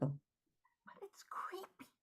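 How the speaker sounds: slow, very soft whisper; creeped-out whisper. Acted. Friend protesting the eerie quiet to Sarah, whispering. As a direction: whisper a drawn-out, uneasy protest.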